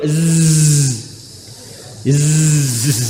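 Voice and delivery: long voiced "Zzz" through closed teeth; buzzing z. Shown as a demonstration. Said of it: "giginya kayak ditutup". (dengungkan "Zzz" dengan gigi tertutup)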